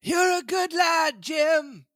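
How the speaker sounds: put-on high, slow old castaway voice; Ben Gunn voice. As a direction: lift into a cracked old sailor. Ben Gunn voiced in read story.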